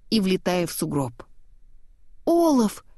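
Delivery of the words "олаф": high, sudden cry of a name; exclaiming. A narrator's reading. Anna running to Olaf's head, high cry. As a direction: call out in alarm. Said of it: "воскликнула Анна".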